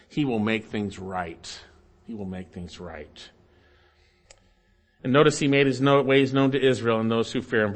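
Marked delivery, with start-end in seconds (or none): 0.1-1.6 slow, lowered promise; weighty, unhurried reassurance; solemn assurance. Real, not acted. Solemn promise, then repeated softer. 2.1-3.3 very soft, low and slow; quiet, solemn echo; hushed assurance. Real, not acted. Hushed repeat of the promise.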